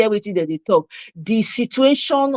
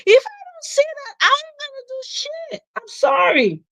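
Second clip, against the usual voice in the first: high put-on voice. Mock refusal in put-on voice.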